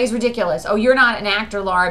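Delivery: ordinary talk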